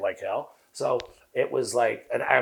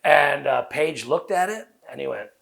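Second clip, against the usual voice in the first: ordinary delivery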